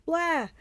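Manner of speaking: high bleating cry; sheep-like bleat. A narrator's reading. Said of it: "Polyphemus bleated just like a sheep".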